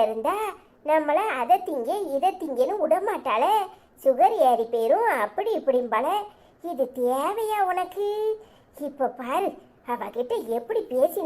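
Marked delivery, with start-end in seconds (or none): none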